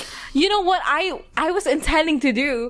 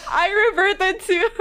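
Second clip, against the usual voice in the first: real laugh; 11 semitones higher, 5 dB louder than usual. Real, not acted. Laughing at Filipino word.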